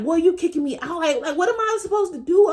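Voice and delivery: high, disbelieving plea; eighth-grader asking grandpa what to do, high and stunned; shocked pleading. Retold or imitated. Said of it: "And I'm just like".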